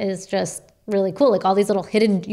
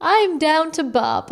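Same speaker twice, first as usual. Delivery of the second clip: mock chirpy; 8 semitones higher, slower than usual. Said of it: "teasing quote of boyfriend".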